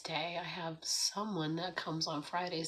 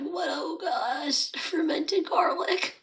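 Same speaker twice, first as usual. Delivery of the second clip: high, shocked gasp at the smell; disgusted shock; 10 semitones higher, 8 dB louder, slower than usual. Retold or imitated. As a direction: recoil from a stink. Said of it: "we were just like".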